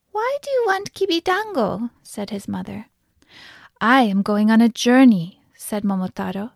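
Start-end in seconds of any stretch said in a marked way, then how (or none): 0.1-1.9 high-pitched storybook mother; high, puzzled old-woman voice; questioning mother. A narrator's reading. Mother questions her son. 3.8-5.4 lightly voiced hero, louder; a hint of a bold storybook boy; bold young hero. A narrator's reading. Young hero announces his adventure.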